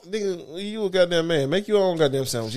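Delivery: usual speaking voice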